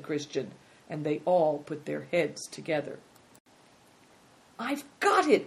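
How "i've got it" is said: high-pitched burst of discovery; excited cry. A narrator's reading. Big Tiger has found an explanation, crying out. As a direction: cry out as the idea strikes. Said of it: "cried Big Tiger".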